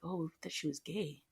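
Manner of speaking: imitating a hushed aside between inmates; retold whispering. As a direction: act out a discreet murmur. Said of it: "whispering a little bit".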